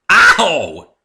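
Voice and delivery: sudden loud high ow; pained yelp. A narrator's reading. The narrator yelps as an apple strikes him, loud. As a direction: yelp as an apple hits your head. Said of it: "I was interrupted unpleasantly".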